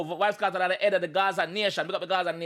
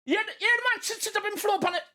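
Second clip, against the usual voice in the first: big up the flow with delight; hyped praise; 9 semitones higher than usual. Real, not acted. Praising the rapper's flow, high excited calling.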